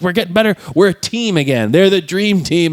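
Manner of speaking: high, rallying team cheer; rally the team like it's back on top; psyched up. Retold or imitated. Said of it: "they get all psyched".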